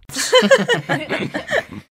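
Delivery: high, loud burst of group laughter; everybody cracks up together; hearty laugh among friends. A narrator's reading. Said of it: "and everybody laughs".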